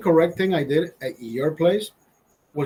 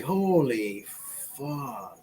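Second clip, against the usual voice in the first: trailing off very soft; stunned, drawn-out; 2 semitones lower, 6 dB quieter, much slower than usual. Retold or imitated. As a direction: imitate dazed awe, fading away. Retells being overwhelmed by the high.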